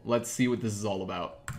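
normal talking voice

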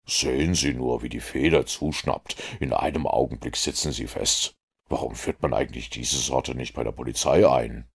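calm low-voiced detective, measured; cool, composed Sherlock Holmes. A narrator's reading.

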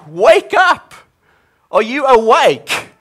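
high, loud cry of alarm; desperate yell to wake Jesus; panicked shout. Retold or imitated. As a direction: shout it like a frightened disciple in a storm. Acting out panicked disciples.

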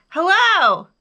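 loud, high hello; big ringing call; shouted. A narrator's reading. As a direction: holler it across the mountains. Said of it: "again he shouted".

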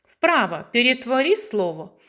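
normal talking voice

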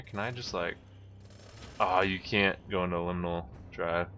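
usual speaking voice

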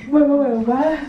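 loud, startled wait wait; surprised shout. Real, not acted. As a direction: blurt it out in shock. Finds friend under bed.

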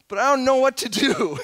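loud, high protest; hammed-up helpless wail; distressed child. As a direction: blurt it out like an overwhelmed kid. Acts distressed child answering parent.

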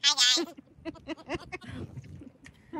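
helium voice. Shown as a demonstration. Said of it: "sounds like I've sucked too much helium".

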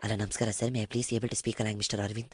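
low, soft, quick broker patter; meek broker. Acted. Prank broker greets customer, meek low murmur. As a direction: play a deferential broker on the phone.

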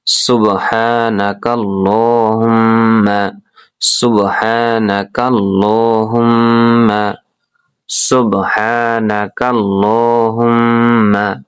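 সুবহানাকাল্লাহুম্মা said very slowly, every sound drawn out; slow recitation demo. Shown as a demonstration. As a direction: recite it slowly for the class to copy. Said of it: "এতটুকু মুখস্থ করেন". (ধীরে ধীরে তিলাওয়াত করে দেখানো)

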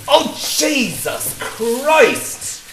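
slow, drawn-out cry of pain; pained groan. Acted. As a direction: drag it out like it really hurts. Agony after something in the eyes.

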